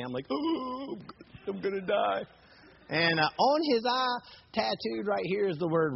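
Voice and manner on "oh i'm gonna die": small, high, frightened whimper; mock terror. Teller facing a man screaming at him, mock terror. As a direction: squeak softly as if doomed.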